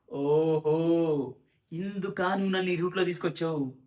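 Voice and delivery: hugely drawn-out "oho", then slow-building reproach; stretched, knowing mock accusation; mock accusing. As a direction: imitate a suspicious girlfriend catching him out. Girlfriend's sarcastic accusation, acted.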